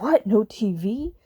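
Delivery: put-on incredulous kids' outcry, rising at the end; drawn-out, rising, disbelieving question; mock freak-out. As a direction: imitate kids in disbelief. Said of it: "the kids are freaking out".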